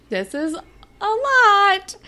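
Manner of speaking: high, slow, stretched-out words; mock overwhelmed. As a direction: imitate someone politely overwhelmed, drawing it out. Voicing overwhelmed date.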